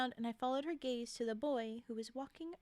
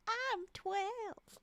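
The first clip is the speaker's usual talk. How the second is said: high, hammed-up little-girl voice; twelve-year-old girl; 8 semitones higher than usual. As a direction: overdo the kid voice. Re-voicing the girl's line for fun.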